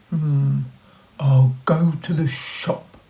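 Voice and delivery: hum, then decide brightly; wolf resolving to go shopping; wolf deciding. Acted. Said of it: "he decided".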